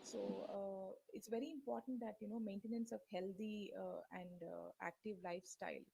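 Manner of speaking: very faint, distant voice; speaking far too softly for the mic; barely audible. Real, not acted. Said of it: "your voice is very low".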